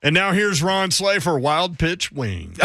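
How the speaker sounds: slow hyped announcer voice; announcer intro. Acted. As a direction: draw out a big ad intro. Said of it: "that was the introduction".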